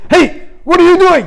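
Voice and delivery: very high, loud challenge; sharp call from a strict teacher; stern teacher. Retold or imitated. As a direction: catch the kids red-handed. Teacher catches them at night.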